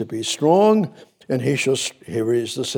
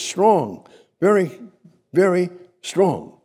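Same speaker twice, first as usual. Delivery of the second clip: building intensity; 6 semitones higher, slower than usual. Acted. Preacher urging the listeners to be strong, rising fervor. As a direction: drive the words home.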